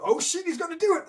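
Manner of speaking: high, thrilled disbelief; excited onlooker. Retold or imitated. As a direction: shout it like you can't believe he'll really do it. Onlookers realise he'll jump.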